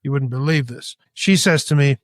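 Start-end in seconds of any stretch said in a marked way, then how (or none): none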